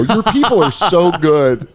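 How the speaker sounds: high, slow mock gushing; gushing praise. Re-enacting his praise to a stranger, gushing. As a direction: stretch out the overdone compliment. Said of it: "that's how I said it".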